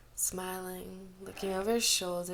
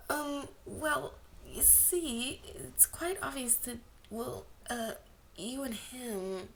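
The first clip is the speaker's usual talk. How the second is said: higher, hesitant and soft; awkward girl; 5 semitones higher, 5 dB quieter, slower than usual. A narrator's reading. Girl awkwardly pointing out Wangji's feelings, higher and hesitant. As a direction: fumble through it shyly, higher.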